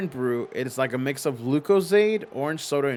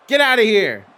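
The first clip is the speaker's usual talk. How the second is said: much higher and louder, playful outrage; mock outraged shout; 9 semitones higher, 12 dB louder than usual. Shown as a demonstration. Streamer timing out a joking chatter, playful loud shout. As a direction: blast it out in fake indignation.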